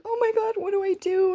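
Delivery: high-pitched, hammed-up panic; mock panic. Crying to a laughing dad, mock panicked plea.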